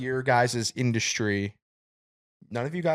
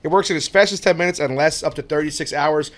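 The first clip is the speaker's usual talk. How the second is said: upbeat sponsor read; 3 semitones higher, 4 dB louder than usual. Acted. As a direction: sell it like a radio ad. Reads a sponsor ad.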